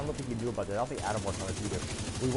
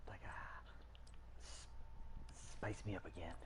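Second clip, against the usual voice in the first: hushed whisper aside; 4 semitones lower, 16 dB quieter than usual. Acted. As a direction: breathe it out as a secret.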